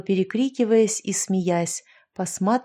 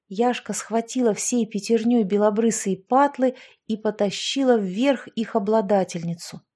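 usual speaking voice

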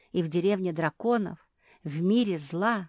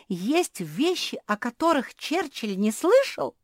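high, swooping incredulous question; shocked disbelief; 7 semitones higher, 5 dB louder than usual. A narrator's reading. Жози doubts the wisest pug's ignorance, amazed. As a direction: ask it in open amazement. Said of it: "ахнула Жози".

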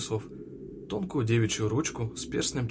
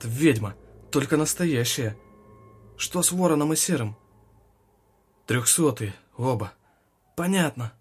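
shouting orders over battle; 3 semitones higher, 5 dB louder than usual. A narrator's reading. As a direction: bark orders in the heat of a fight.

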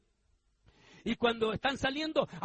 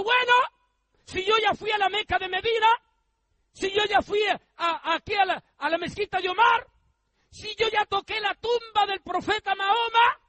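excited reasoning; 10 semitones higher, 8 dB louder than usual. Retold or imitated. El musulmán razona exaltado.

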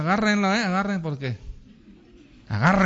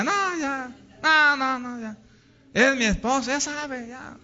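high, whiny brush-off; dismissive husband; 7 semitones higher than usual. Acted. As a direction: shrug off romance in a pitched-up voice. Husband dismisses rose and poem. (marido que se zafa, quejoso y agudo)

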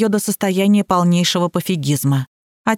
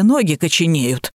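plain reading voice

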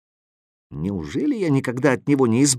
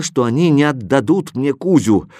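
ordinary delivery

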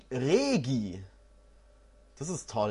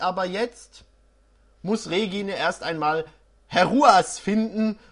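usual speaking voice

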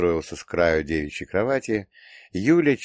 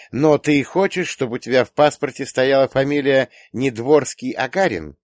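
raised, formal official questioning; stern registrar; 7 semitones higher, 4 dB louder than usual. A narrator's reading. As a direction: ask it like a strict registry clerk. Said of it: "как строгая тётя из загса". (серьёзно, как строгая тётя из загса)

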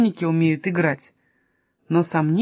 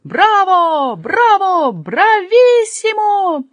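high, loud, drawn-out shouts of bravo; loud cheering; 11 semitones higher, 7 dB louder, much slower than usual. A narrator's reading. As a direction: cry out in rapture, stretching the last word. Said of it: "громко кричал".

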